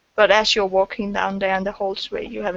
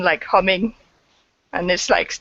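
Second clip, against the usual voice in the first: usual speaking voice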